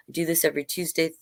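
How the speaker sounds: plain reading voice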